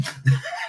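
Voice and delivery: real burst of laughter, squeaky; helpless giggle at herself; snorting laugh; high squeal laugh. Real, not acted. Laughs at own bad accent.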